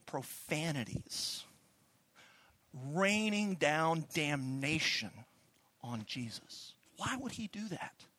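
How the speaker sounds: ordinary talk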